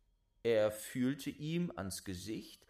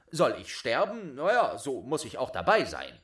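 louder, quick unbothered retort; fearless cocky boy; 8 dB louder, faster than usual. Acted. Boy answers the giant, cocky and loud. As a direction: shrug off the death threat.

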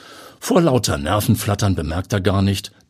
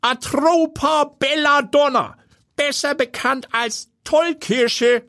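put-on high, slow old man lecturing; lecturing grandpa; slower than usual. A narrator's reading. Grandpa naming the poisonous berry, lecturing in a high, slow voice. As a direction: pronounce it slowly like a didactic old grandpa. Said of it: "hebt dozierend den Zeigefinger".

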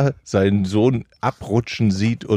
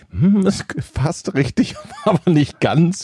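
gentle teacher; 7 semitones higher, slower than usual. Pretend. (sanft gespielte Lehrerin, lachend)

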